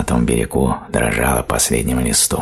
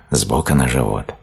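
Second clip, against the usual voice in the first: usual speaking voice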